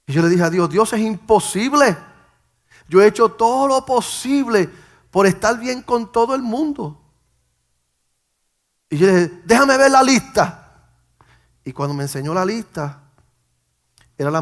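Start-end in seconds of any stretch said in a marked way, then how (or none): 0.7-7.0 raised, loud voice pleading your case; impassioned protest. Retold or imitated. Pastor pleading his case to God, impassioned protest. 9.4-10.6 loud, high-pitched insistence; urgent demand. Retold or imitated. Man demanding to see the list at heaven's gate, loud demand.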